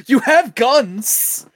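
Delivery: loud, slow exasperated yell; exasperated shout. Real, not acted. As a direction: yell it in disbelief. Exasperated at game characters.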